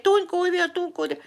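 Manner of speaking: high-pitched inner scream, retold; high mock-panicked warning. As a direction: plead in alarm, as if screaming in your head. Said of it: "I was screaming in my head".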